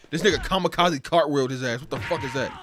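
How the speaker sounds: normal talking voice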